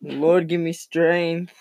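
high, loud, slow mock prayer; a queasy hungover groan to the Lord; dramatic plea. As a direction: beg heaven like a hammed-up martyr. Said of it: "I was like".

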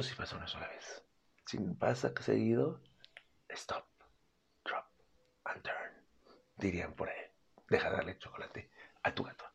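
hushed, secretive warning; whisper. Real, not acted. Vet to cat owners, whispering a stern warning. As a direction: breathe it like a secret to the viewers.

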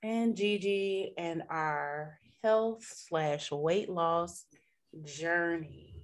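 very slow, soft late-night DJ; slow jams radio voice. Acted. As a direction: croon it like a quiet storm host. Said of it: "that sounded very, like, Love Talk and Slow Jams".

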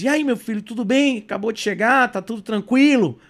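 high, loud cheery greeting; excited parents. Retold or imitated. Parents greeting their son's first call, excited. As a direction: imitate parents eagerly checking in.

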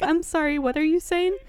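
high, loud incredulous question; can't believe what she hears; disbelieving. Retold or imitated. Evelyn answers her partner, loud disbelief.

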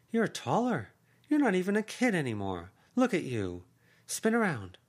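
voiced aunt, high and urging; effusive aunt. Aunt fussing over niece.

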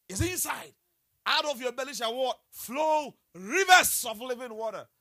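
high, ringing preacher's declaration; forceful proclamation. Real, not acted. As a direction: proclaim scripture at full force. Proclaims scripture to the congregation.

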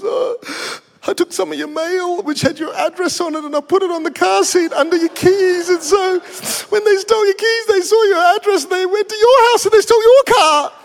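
very high wail building to a loud squeal; mock hysterical crying. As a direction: imitate a distraught caller falling apart completely. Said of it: "She's in tears".

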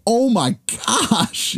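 high, very slow drawn-out oh my gosh; mock horror stretched out long; hammed-up gasp. Coach spinning round to the injured kid, stretched high gasp. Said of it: "he didn't say".

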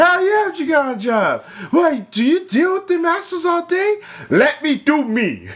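high, loud, fast cocky reply; brash coworker voice. Pretend. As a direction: toss it off like a careless slacker.

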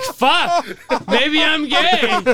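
high, slow, dragged-out mock confusion; confused dude. The dude doubts himself, high slow mock voice. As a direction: draw it out like a stunned dude. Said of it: "And the dude was like".

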